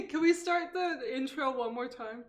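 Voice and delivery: ordinary delivery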